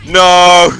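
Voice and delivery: high, loud protest; outraged shouting. Real, not acted. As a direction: cry out at a game going wrong. Shouting at the lost game.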